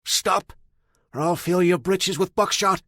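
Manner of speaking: put-on loud, high old man's yell; old man yells a warning at the approaching stranger. A narrator's reading. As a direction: bellow a warning across the clearing. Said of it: "The old man yelled".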